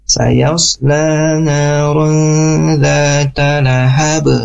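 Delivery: very slow, drawn-out recitation, "lahab" ending in an echoed b; bouncing final b. Shown as a demonstration. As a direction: hold each long vowel and bounce the b of "lahab". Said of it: "Yang ketiga pun juga sama". (bacaan tartil pelan, "lahab" memantul)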